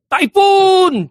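high, loud yell of typhoon; shouted warning. Retold or imitated. Ramos at the firing range, shouting to distract soldiers. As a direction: yell it out as a false alarm.

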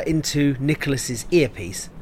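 usual speaking voice

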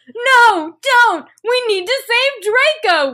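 high, loud mock pleading; begging. A narrator's reading. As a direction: beg urgently, hammed-up. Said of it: "we begged".

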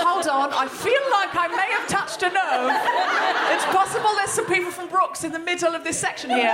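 comic on stage clocking offended Brookes fans in the audience, mock alarm. Real, not acted. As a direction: lightly pretend to be caught out, laughing. Teasing the Brookes crowd.

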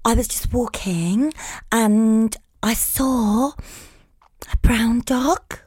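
slow, drawn-out influencer parody; Instagram story voice. Acted. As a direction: stretch phrase ends like a story-posting influencer. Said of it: "If there's an Insta voice".

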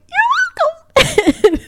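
very high, drawn-out retold squeal; high gushing reply. Tourist answering the postal clerk, high-pitched gushing. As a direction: stretch it out in a tiny high voice.

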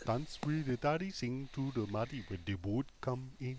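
softly chanted mock Geordie; Geordie accent. Showing off his chosen accent to the podcast, Geordie. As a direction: recite a folk rhyme in put-on Geordie. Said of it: "sort of Geordie and support Sunderland".

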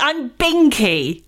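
slow, lightly posh I'm Binky; a hint of a posh girl introducing herself; posh self-introduction. Host imitating a Made in Chelsea star, mildly posh and slow. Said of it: "is that how you'd introduce it".

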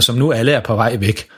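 plain reading voice

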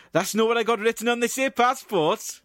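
high, loud Welsh officer's voice; a comic Welsh accent, challenging. Acted. Welsh accent for the officer doubting Barry's passport. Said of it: "performing their dialogue in a Welsh accent".

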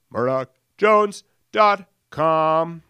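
drawn-out radio plug; slow announcer voice. Pretend. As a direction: announce the website slowly and loudly. Plugging the website.

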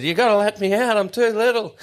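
high, laughing plea to be let off the ride; panicked kid plea. Retold or imitated. Retelling to mates how he begged to get off the Zipper, high and laughing. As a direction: beg the ride operator like a scared little kid. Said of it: "and I said".

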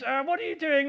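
mock the critic in a silly little voice; a put-on silly voice asking what he's doing. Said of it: "In a silly little voice".

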